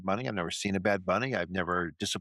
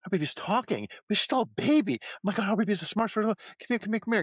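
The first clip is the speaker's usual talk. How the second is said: much higher, gushing parent voice; thrilled parent; 11 semitones higher than usual. Retold or imitated. Sketch parent gushes over the baby in a much higher voice. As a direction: gush over the talking baby. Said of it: "a husband and wife".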